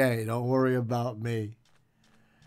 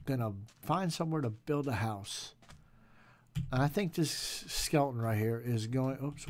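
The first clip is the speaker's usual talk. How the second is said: normal talking voice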